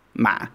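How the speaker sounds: ma low and tight; low constricted tone. Shown as a demonstration. Teacher demos the heavy tone for learners. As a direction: press ma low in the throat. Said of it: "low constricted tone".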